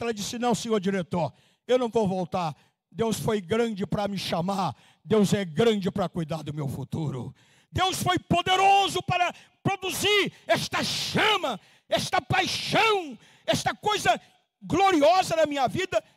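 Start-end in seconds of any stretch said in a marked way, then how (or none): 0.4-7.3 calm firm resolve. Acted. Guiomar refuses the bank director. 7.7-16.0 high, slow preaching shout; Guiomar's faith shouted high and slow, full preaching force; fervent shout. Acted.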